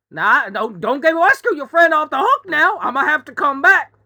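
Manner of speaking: loud, high Southern chicken; high Southern accent, hammed-up scolding; Foghorn Leghorn. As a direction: berate them like a blustering cartoon rooster. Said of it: "chicken with a high southern accent".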